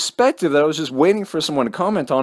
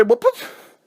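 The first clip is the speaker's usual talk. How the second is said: put-on whiny teenage comeback, higher; teen back-talk; 8 semitones higher than usual. Grounded teen mouthing off to his stepmom, higher and whiny. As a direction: sulk and snap back like a teenager. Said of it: "I'd say".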